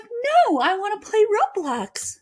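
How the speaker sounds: mock child pleading to play a game; imitating whiny kid. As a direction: whine like a child begging for Roblox. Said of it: "she's like".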